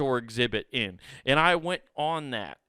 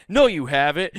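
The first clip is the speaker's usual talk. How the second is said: put-on childish argument; 8 dB louder than usual. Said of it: "I turned it into a preschooler argument".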